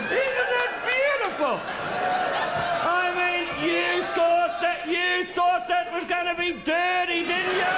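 high, loud triumphant teasing; gleeful shout. Real, not acted. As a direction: crow at the crowd for their dirty minds.

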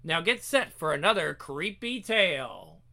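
ordinary delivery

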